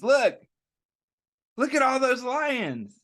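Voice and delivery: high, loud pointing out; excited kids. A narrator's reading. Reading to children, kids point at imagined lions. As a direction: call it out with delight.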